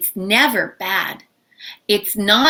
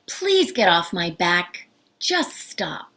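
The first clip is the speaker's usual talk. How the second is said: low, restrained polite plea; calm; 4 semitones lower, 3 dB quieter than usual. Shown as a demonstration. Teacher showing the polite way to stop nagging, calm. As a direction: say it softly and evenly, pitch down. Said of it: "to say it in a calm way".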